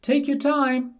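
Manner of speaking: high, loud taunting piglet voice; cheeky piglet. A narrator's reading. Percy taunting the wolf from inside, high and loud. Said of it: "said Percy".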